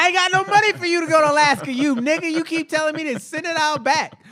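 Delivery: high, loud, fast scolding mother; mock mom. Mocking his mom ranting about money, high and fast. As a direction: rant like a fed-up mama.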